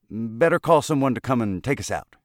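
trapped lobbyist nervously urging a rescue call. Acted. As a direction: push it out in a nervous rush. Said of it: "he said nervously".

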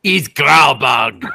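slow, loud mock accent, "He's Kralbug" bellowed; shouted mock accent. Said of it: "if they talk like that".

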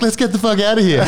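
scared friend tells his mate to run, high mock panic; imitating a panicked exit. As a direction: urge your mate to flee, voice jumped up. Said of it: "the other guy's like".

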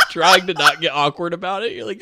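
ordinary talk

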